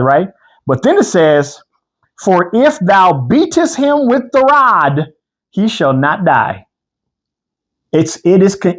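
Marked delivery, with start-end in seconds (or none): 2.2-6.6 solemn scripture reading. Acted.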